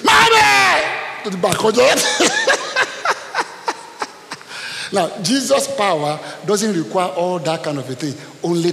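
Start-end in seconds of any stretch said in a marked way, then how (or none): 0.0-0.8 put-on high loud child's scream; scream for mommy. Little girl left in the dark screaming for her mother. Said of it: "She screamed". 1.6-4.8 high, long laugh; high burst of laughter. Real, not acted. Pastor laughing at his wife's childhood story.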